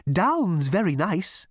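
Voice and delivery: high, slow hopeful elevator voice; hopeful suggestion. A narrator's reading. Elevator suggesting down to Zaphod, hopeful and high. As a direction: coax slowly, pitched high.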